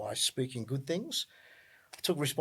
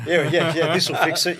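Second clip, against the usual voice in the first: louder, laughing mock confidence; mock optimism; 4 semitones higher, 10 dB louder than usual. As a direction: say it through a laugh, loudly. Jokes about another baby fixing things.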